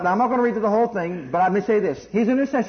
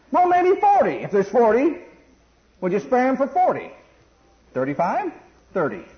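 put-on a touch of a haggling child, higher; childlike bargaining; 5 semitones higher, 3 dB louder than usual. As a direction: keep nudging the price down like a kid. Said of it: "acting like a little kid".

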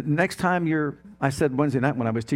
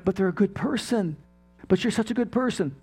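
higher-pitched pleading objection; mock protest; 6 semitones higher than usual. Preacher mimics people excusing the unsaved, high mock protest. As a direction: lift the pitch as if defending someone.

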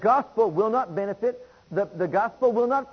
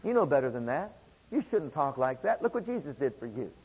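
low, soft and kindly admonishing; hushed, gentle reproof; gentle correction; 6 semitones lower, 5 dB quieter than usual. Acted. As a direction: talk someone down gently, voice dropped low. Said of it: "to gently talk to me and say".

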